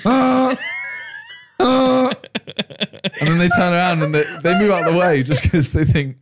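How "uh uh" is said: high-pitched "uh", repeated; short high honk-like "uh"; imitated impatient noise. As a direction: imitate a high noise behind a slow walker. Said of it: "You're like".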